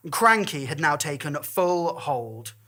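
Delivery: ordinary delivery, nothing marked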